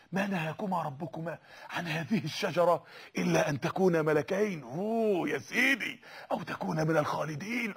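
Satan luring Adam, low and sly; tempting devil. Acted.

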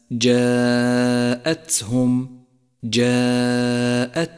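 جاءتهم with a long chanted aa and breath released on the ت; slow جاءتهم, airy t before hum; whispered-release ta. Shown as a demonstration. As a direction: let air flow after ت in جاءتهم. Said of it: "لاحظ صفة الهمس لحرف التاء".

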